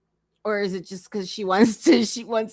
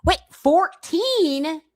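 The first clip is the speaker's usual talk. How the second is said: disbelief; 8 semitones higher, 5 dB louder, much slower than usual. Real, not acted. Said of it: "Is she serious?".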